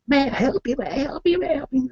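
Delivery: very high, repeated offers of help; hammed-up eager helpers; pestering store clerks. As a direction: badger the customer in a high-pitched chant. Eager store staff mobbing shopper.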